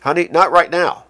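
loud, exasperated brush-off; loud and angry. Shown as a demonstration. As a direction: snap it with mounting impatience. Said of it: "in a loud and angry voice".

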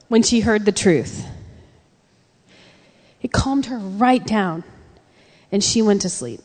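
usual speaking voice

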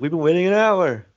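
high-pitched imitation of an impatient queue; mock complaint. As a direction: complain as the people kept waiting. Acted complaint from people in line.

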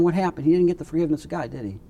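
usual speaking voice

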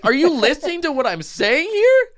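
high, incredulous rant; exasperated. Retold or imitated. Worker retelling rant at pestering kid, exasperated. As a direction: let the disbelief climb high in pitch.

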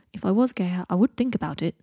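plain reading voice

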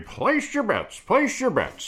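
high, slow croupier call; casino announcer. Acted. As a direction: call out the bets like a casino dealer. Calling out to the room like a croupier.

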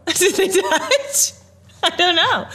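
laughing. Real, not acted.

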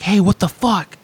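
high-pitched indignant yell at the usher; mock outraged shout over a missing chair; retold yelling. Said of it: "I yelled at poor Melissa".

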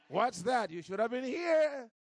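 loud, high, jubilant call; excited shout. Retold or imitated. Woman boasting to newcomers at the door, excited shout. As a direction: shout it out in glee.

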